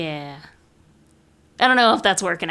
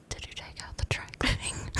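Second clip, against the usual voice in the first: hushed unvoiced whisper; 15 dB quieter than usual. Sleep-talking brother whispering a question at her. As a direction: lean in and whisper it. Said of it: "and then he would say".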